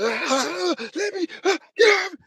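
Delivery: high, strained, desperate cries; panicked cries. Retold or imitated. Flailing in the box like a trapped animal, panicked cries. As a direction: thrash like an animal in a bear trap. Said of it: "I was on that type of energy".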